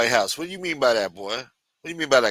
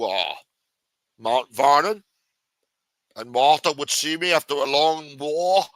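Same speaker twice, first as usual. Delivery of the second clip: higher, louder mock Scottish Connery voice retelling a quarrel; Sean Connery accent; 3 semitones higher, 4 dB louder than usual. Washington recounting row with Martha, Scottish Connery accent. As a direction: ham up Washington as Connery complaining about Martha. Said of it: "What do you think he sounded like".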